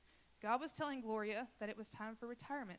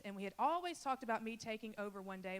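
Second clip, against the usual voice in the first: normal talking voice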